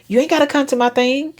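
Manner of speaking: you ain't gotta said high and genuinely easygoing; mean it, no edge at all; sincere. Shown as a demonstration. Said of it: "And mean it".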